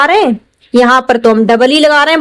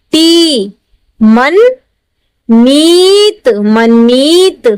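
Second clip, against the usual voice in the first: slow sounding out; much slower than usual. Shown as a demonstration. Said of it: "teacher sounds out word".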